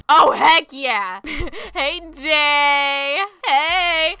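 usual speaking voice

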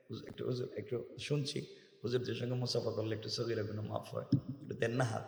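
soft, low, hesitant request; mock-humble petitioner speaking softly; imitated meek plea. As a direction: ask timidly under your breath, low. Acts timid plea for handshake.

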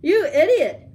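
high, loud storybook bellow; angry bellow. A narrator's reading. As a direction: bellow it out as an outraged tiger. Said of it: "bellowed the tiger".

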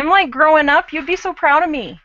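mock-bragging with a lifted voice; playfully proud. Real, not acted. Playfully boasts to co-host.

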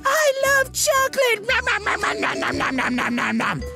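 squeaky nom song. Acted. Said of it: "the Kevin Nom Nom song".

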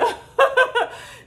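high burst of laughter. Real, not acted. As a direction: laugh it out loud. Laughing about baby space.

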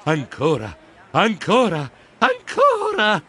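"ancora" escalating to a high, stretched final cry; building repetition. A narrator's reading. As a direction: climb in pitch on each "ancora". Repeated word builds while pedalling.

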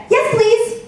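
put-on a very polite little girl saying yes. Mom voicing daughter politely agreeing to Alexa, high sweet child voice. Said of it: "Lainey very politely said".

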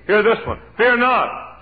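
high, loud preacher's call; proclaimed promise. Acted. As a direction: ring it out to the congregation.

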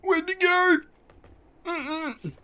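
very high, squeaky mock panic; high squeal. Player losing track of an enemy, high squealed mock panic.

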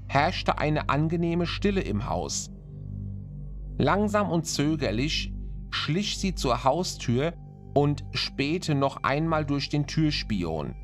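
plain reading voice

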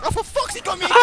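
very high, comic outraged cry; exasperated yelp. Real, not acted. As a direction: squeal in exasperation at being caught. Caught again by a monster.

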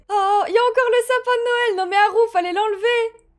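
high-pitched amused protest; excited disbelief. Real, not acted. Player spots the Christmas tree still up, excited disbelief. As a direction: exclaim it high, half scolding.

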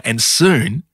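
usual speaking voice

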